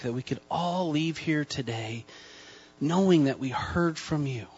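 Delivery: soft, hushed prayer voice; hushed opening prayer before the sermon. Acted. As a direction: pray quietly and earnestly.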